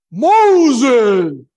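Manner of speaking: a huge, high shout of Moses; booming call. Acted. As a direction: call him out like a voice from the fire. God calling Moses from bush.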